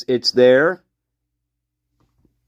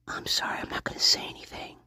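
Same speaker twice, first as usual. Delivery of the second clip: soft unvoiced mock whisper, a bit hurried; imitating a whisper; 15 dB quieter, faster than usual. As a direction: whisper an apology like the suspect. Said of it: "And then she says".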